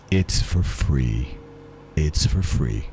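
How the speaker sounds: deep voice. Pretend. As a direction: drop way down and drag it out. Redone in a deep slow voice.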